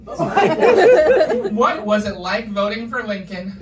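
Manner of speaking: loud, slow teasing questions about age; hammed-up prying at an old person; mock nosy questions.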